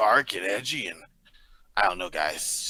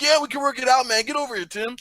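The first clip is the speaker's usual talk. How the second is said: high, louder eager coaxing; eager excited; 12 semitones higher, 3 dB louder than usual. Retold or imitated. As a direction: beg him over, all pumped up. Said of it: "I'm over here like".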